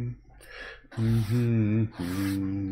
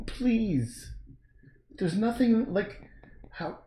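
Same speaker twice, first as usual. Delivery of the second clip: higher pleading to the dog; 8 semitones higher, slower than usual. Real, not acted.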